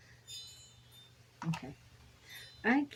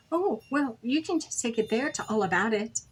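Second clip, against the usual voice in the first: high, louder helpful mom voice; retold self quote; 5 semitones higher, 4 dB louder than usual. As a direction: play yourself pointing out the store. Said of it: "I said".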